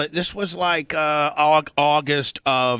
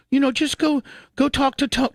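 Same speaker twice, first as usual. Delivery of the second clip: high, lightly voiced fiance urging him; a hint of a pleading girlfriend, higher; mock fiance voice; 12 semitones higher than usual. Fiance telling him to go see Tony, high mock voice.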